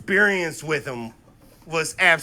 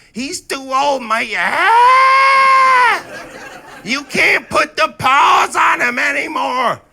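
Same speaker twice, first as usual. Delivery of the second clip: high, loud screeching mother; hammed-up impression of a shrieking mom; upset mom voice; 8 semitones higher, 7 dB louder, slower than usual. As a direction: scold with crow-like squawks. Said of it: "when she gets upset at me".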